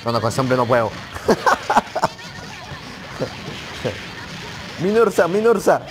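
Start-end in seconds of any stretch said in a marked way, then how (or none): 1.0-4.8 long, high laugh tailing down; high cackling laugh. Real, not acted. Player laughing at an unbeatable opponent, high cackle. 4.8-5.8 high, loud repeated cry; excited yelling. Real, not acted. Player calling out mid-match, high excited yell.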